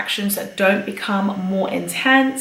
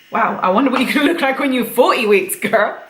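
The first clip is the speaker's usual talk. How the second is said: teasing; 4 semitones higher, 3 dB louder, faster than usual. Acted. Teasing pregnant friend.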